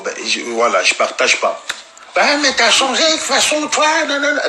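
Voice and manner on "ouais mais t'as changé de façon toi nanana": very high whiny mock girl; high squeaky parody of her complaining; mocking the girl's voice. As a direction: whine it like a petulant girl. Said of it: "elle me sort plein de trucs".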